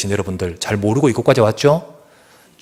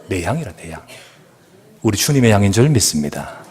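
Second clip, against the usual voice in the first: normal talking voice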